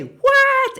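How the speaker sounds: very high mock squeal; high squeaky catchphrase. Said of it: "that make us go".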